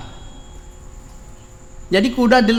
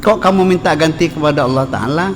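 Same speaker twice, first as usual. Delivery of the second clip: forceful Arabic recitation; 3 dB louder than usual. Acted. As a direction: declaim the Arabic like a preacher at full voice. Recites Arabic text at full force.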